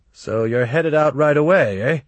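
brightly eager to see them off; cheerful. Acted. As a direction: ask it too cheerfully, lifting toward the end. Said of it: "sound so damn cheerful about it".

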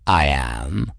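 low cat voice; talking cat. A narrator's reading. Cat declaring himself her second, low and firm. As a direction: answer as the cat, pitched low.

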